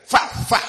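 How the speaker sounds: unvoiced puffs like beating a mattress; breathy slapping sounds, fa fa; mock beating puffs. Old Manuel beating the wool mattress, puffed unvoiced. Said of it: "agarraba el corchó y decía".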